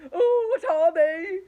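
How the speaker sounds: high, loud, slow fearful cry; worried earthworm crying out; frightened wail asking what they are; getting worried. A narrator's reading. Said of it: "getting worried".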